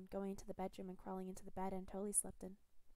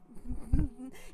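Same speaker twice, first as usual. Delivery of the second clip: amused giggle; 6 semitones higher, 12 dB louder than usual. Acted. Captor laughing mid-sentence.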